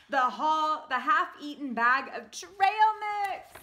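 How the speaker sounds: loud, high gasping announcement; big shocked reveal; dramatic gasp reveal. A narrator's reading.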